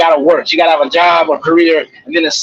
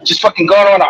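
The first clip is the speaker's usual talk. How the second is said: normal talking voice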